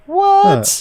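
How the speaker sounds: high incredulous yelp; surprised. Real, not acted. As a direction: blurt it out in disbelief. Reacting to absurd game description.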